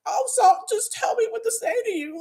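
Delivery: high-pitched mimicked bewilderment; confused pleading. Doctor who was so confused by her crying, pleading. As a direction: sound lost, begging for guidance. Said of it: "so confused".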